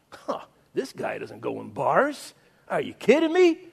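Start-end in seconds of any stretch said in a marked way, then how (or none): none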